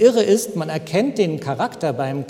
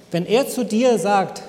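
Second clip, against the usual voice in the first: ordinary talk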